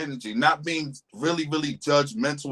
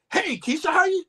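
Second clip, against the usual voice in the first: pitched way up, overeager; mock high hello to a woman; goofy high greeting; 13 semitones higher than usual. Shown as a demonstration. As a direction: squeak it out like a goofy guy. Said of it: "I feel real goofy".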